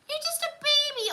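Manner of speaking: high squeaky teasing; high, cutesy "you're just a baby"; playful baby talk. Pretend. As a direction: tease them like a little kid. Teasing viewer who is seventeen.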